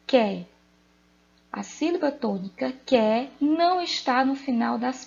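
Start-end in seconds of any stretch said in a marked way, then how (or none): 0.1-0.4 "Que" alone, loud and firm; stressed syllable demo. Shown as a demonstration. Teacher answering which syllable of raquete is tonic, "Que" firmly stressed. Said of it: "Qual a sílaba tônica?".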